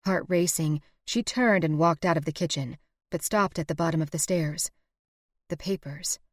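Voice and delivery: ordinary delivery, nothing marked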